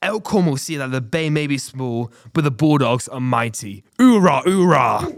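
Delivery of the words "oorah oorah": slow, loud, higher battle chant; loud gung-ho soldier. Acted. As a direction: bark it like a marine. Rallying cry for the Bulldogs.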